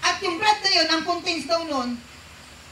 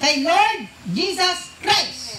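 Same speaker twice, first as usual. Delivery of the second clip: "Lord Jesus Christ" drawn out very slowly, each word weighted; slow emphatic answer; 3 semitones higher, much slower than usual. Shown as a demonstration. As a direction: spell out the answer slowly for the crowd. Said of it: "Tumutukoy kanino?". (dahan-dahang binibigkas ang "Kay Lord Jesus Christ")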